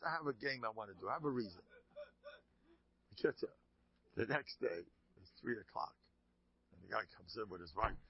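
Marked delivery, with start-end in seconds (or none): none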